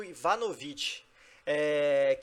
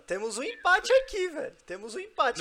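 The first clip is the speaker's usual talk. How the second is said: high, loud excited announcing; 10 semitones higher, 3 dB louder than usual. Real, not acted. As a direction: announce the tie with a jump in pitch. Announces a surprise tie.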